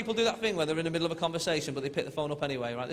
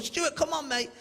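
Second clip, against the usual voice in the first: worried urging; 10 semitones higher, slower than usual. Retold or imitated. Urging collapsed friend to respond.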